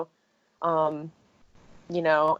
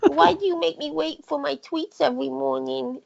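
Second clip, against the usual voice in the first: high cartoon pet complaining, rising to a louder burst; Chrono's voice; 9 semitones higher, 6 dB louder than usual. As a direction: whine like a spoiled cat who wants the tweets now. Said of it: "and Chrono goes".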